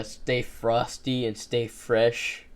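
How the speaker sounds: drawn out and cool; slow sign-off. Shown as a demonstration. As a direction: lay it on slow. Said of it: "trying out a sign-off".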